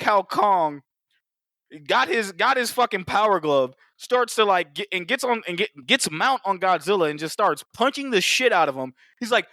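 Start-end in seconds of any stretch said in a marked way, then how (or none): none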